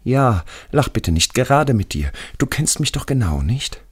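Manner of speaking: hohe, bittende Stimme eines Gefallenen; ängstlicher toter Schulfreund. Acted. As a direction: als toter Freund scheu um Verständnis bitten. Radi pleads with narrator. (hoch, unsicher und eindringlich)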